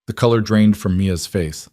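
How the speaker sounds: ordinary delivery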